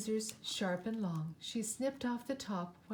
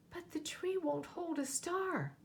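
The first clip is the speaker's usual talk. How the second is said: little bear cry; 8 semitones higher than usual. A narrator's reading. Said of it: "cried out".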